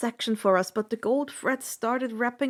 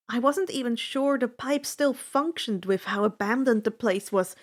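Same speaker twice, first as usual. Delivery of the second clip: ordinary delivery, nothing marked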